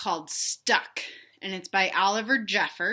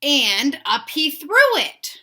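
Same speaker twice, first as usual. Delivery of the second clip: slow, higher and louder storybook build; reading to children; 6 semitones higher, 10 dB louder, slower than usual. A narrator's reading. Teacher reading Stuck to young kids, big storybook voice. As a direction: lift the line up high to make it dramatic. Teacher reads picture book aloud.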